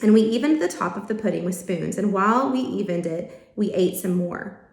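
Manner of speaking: ordinary delivery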